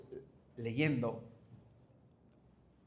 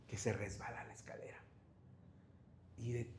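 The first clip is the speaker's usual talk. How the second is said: hushed whisper; 6 semitones lower, 16 dB quieter than usual. Acted. Dramatic turn in the story.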